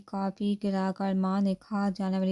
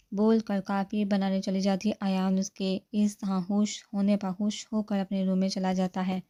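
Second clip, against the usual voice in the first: plain reading voice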